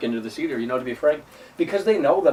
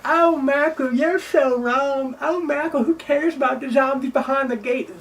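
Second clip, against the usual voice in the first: much higher mock whine, drawn out; mocking fake fan; 9 semitones higher, slower than usual. Mocking fake fans typing in the comments, high mock whine. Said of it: "fake Resident Evil fans are typing down below".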